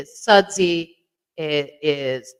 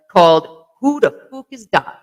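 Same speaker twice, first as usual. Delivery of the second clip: a quick, hammed-up Irish accent, a bit louder; name the beer in a jokey Irish brogue that drifts Jamaican; mock Irish accent; 6 dB louder than usual. Naming an Irish IPA for viewers, mock Irish accent. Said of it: "sounded more Jamaican than it did Irish".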